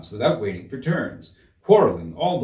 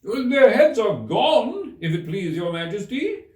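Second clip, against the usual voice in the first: high, slow, loud reply; soldiers shouting; 11 semitones higher, 5 dB louder, slower than usual. A narrator's reading. Soldiers report to the queen, loud slow shout. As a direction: call it out to the queen. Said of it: "the soldiers shouted out".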